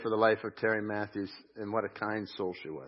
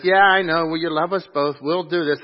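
higher, louder, mock exasperation; exasperated reply; 7 semitones higher, 11 dB louder than usual. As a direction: humor the old lady, raising the voice. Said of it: "And I was like".

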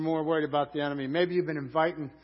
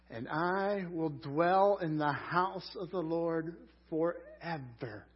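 slow, drawn-out proclamation; grand crescendo; much slower than usual. Acted. Preacher reading David's closing line as a slow grand crescendo. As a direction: build it grandly to forever. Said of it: "he ends with a grand crescendo".

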